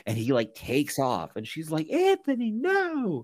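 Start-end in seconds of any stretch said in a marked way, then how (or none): 1.9-3.3 high, pleading cry of a name; much higher, pleading him to stop. Retold or imitated. Brianna begging Anthony to stop, high pleading cry.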